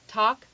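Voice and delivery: "talk" with open ah, tahk; standard American accent. Shown as a demonstration. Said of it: "the standard American accent says talk".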